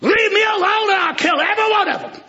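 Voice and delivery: thundering God. Acted. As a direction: roar the threat as God. God's voice, loud high.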